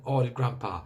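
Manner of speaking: plain reading voice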